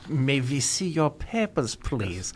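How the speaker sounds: mock German officer accent, slow and clipped at the end; imitating a German officer demanding papers. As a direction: play a stern German checkpoint guard. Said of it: "I love when you do that accent".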